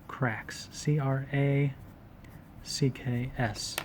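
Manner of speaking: cracks, then C R A C K S letter by letter; spelling it out. Shown as a demonstration. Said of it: "spelling out for notes".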